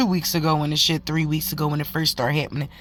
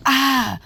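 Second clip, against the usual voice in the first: short high pained "ah"; pained gasp; 8 semitones higher, 4 dB louder than usual. Retold or imitated. Daughter flinching as mom touches her face, high pained gasp. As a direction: wince as if the face hurts when touched.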